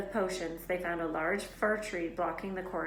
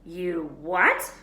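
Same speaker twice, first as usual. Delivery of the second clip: shocked; 8 dB louder than usual. A narrator's reading. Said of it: "Hagrid looked shocked".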